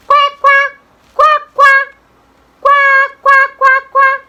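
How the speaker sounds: put-on high, loud girlish quacking; short, high-pitched, loud duck quacks. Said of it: "the duck says".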